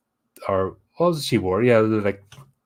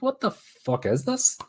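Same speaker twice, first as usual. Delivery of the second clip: baffled; 12 semitones higher, slower than usual. Retold or imitated. Imagined baffled reaction.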